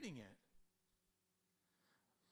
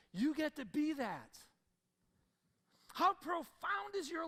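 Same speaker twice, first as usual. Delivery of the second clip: loud, high, impassioned sermon build; preaching at full force; 8 semitones higher, 8 dB louder than usual. Acted. Pastor preaching to his congregation, loud and impassioned. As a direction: raise it up like a revival preacher.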